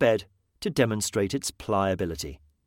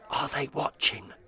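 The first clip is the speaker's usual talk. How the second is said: hushed whisper; 2 semitones higher, 6 dB quieter than usual. Acted. Conspiratorial question in cafe.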